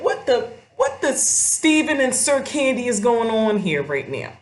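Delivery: loud, high what-the-hell reaction; shocked disbelief. Retold or imitated. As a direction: react like you can't believe your eyes. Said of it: "that's my first thought".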